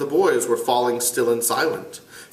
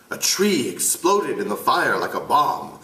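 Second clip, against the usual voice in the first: usual speaking voice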